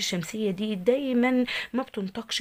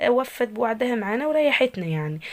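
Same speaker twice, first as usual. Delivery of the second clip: ordinary delivery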